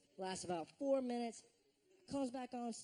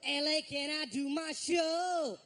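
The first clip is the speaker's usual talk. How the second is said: hamming up a rambling R&B star on the mic; R. Kelly impression; 3 semitones higher than usual. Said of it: "He talks like R. Kelly talks. So he goes like this".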